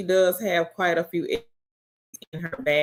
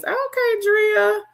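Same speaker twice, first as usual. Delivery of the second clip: excited; 3 dB louder, slower than usual. Real, not acted. Excited shoutout.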